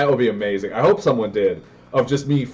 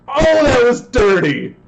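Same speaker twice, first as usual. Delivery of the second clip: even higher, slow, loud cry of disbelief; stretched excited yell; 10 semitones higher, 4 dB louder than usual. Real, not acted. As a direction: drag it out in amazement. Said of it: "I am yelling super loud".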